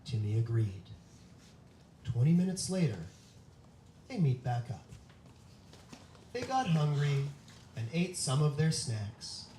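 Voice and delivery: loud, dramatic storytelling voice; spooky story narration. Acted. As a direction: read it like a campfire ghost story. Reads kids' scary story aloud.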